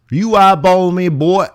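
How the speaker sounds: hammed-up threat; mock tough guy. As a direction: challenge him like a playground bully.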